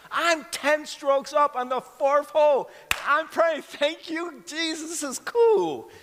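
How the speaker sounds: joyful high-pitched thanks; gleeful excitement. Retold or imitated. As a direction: crow it out like a kid winning. Gleeful retelling of golf win.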